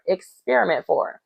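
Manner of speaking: ordinary delivery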